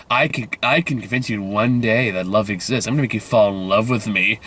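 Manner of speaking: mock-solemn movie suitor; dead-serious poet. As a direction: drop the voice and play it dead straight. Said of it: "dead serious".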